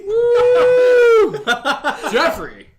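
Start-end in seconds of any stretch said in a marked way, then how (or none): 0.1-1.4 high, loud laughing whoop. Real, not acted. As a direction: whoop in delight at the joke. Cheering the roast.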